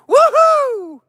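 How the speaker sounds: loud, very high whoop; rodeo-clown whoop. Pretend. Man playing rodeo clown, loud high whoop. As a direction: let out a big showy woohoo.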